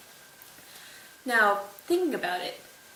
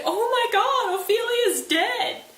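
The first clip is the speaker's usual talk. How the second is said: much higher and louder, crying out the news; queen rushing in, shouting the terrible news; frantic queen; 14 semitones higher, 6 dB louder, slower than usual. Acted. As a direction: burst in shrieking that Ophelia's dead. Queen runs in upset.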